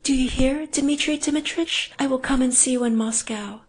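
loud, high, desperate begging; frantic pleading woman. Acted. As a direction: implore him to leave now.

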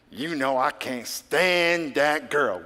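slow, grudging confession; mock petty prayer. Congregant confessing a grudge in prayer, slow and grudging. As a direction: drag out the grudge slowly.

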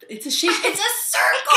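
high laughing shout; excited shout. Real, not acted. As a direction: squeal the answer with delight. Excited reveal of the shape.

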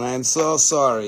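ordinary talk, nothing marked

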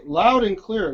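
LOUD drawn out and emphasized; "loud" stretched out for emphasis; stress on LOUD. Real, not acted. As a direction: lean slowly on the word loud. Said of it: "emphasis on the word loud".

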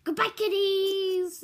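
slow, high mock Russian goodbye; Russian accent. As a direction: lay on a hammy Russian accent. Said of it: "this is my Russian accent".

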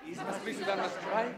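breathy whisper under the breath; whispered repeat. Acted. Prince repeats the riddle to himself in a whisper. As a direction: whisper it back to yourself.

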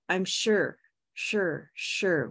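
"sure" drawn out slowly with a purple vowel. Shown as a demonstration. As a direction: stretch out each "sure". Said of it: "I'll do that slowly".

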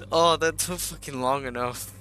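loud, high cheer; excited cheer. Real, not acted. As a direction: cheer with relief. Celebrating end.

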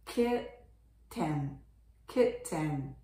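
kitten with a full, clear e: kit-TEN; over-pronounced. Shown as a demonstration. Teacher making the pronunciation thicker, over-pronounced. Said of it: "発音をもうちょっと濃ゆめにすると".

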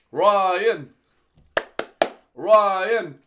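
loud drawn-out shout of the name; cheering a name. Real, not acted. Streamer cheering viewer Ryan, loud chant of his name. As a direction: cheer him like a stadium announcer.

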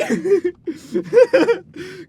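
bursting into loud laughter; a hearty real laugh. Real, not acted. Laughing at own joke.

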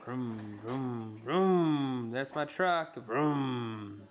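low, drawn-out engine vroom; truck vroom. Pretend. Voicing a toy truck for child.

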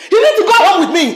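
high, loud demand; angry order. Acted. Mother demanding her son go home, loud and angry. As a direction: order him loudly, allowing no argument.

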